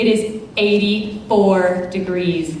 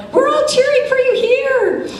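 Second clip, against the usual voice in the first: much higher mock yell over party noise; yelling over a crowd. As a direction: call it out over a noisy room. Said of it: "he's yelling over the crowd".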